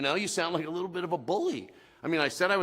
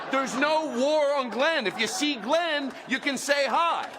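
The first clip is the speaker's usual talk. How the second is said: comedic yell; 8 semitones higher than usual. Acted. Comic answers the complainers with amplified yell. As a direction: blow it up for laughs, almost yelling. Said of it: "That's not yelling. That's, uh, comedic amplification".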